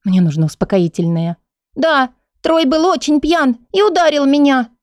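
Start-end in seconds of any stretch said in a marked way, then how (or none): 1.8-4.7 put-on high child voice; little girl voice. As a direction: play a small girl confessing. Child tells who hit her.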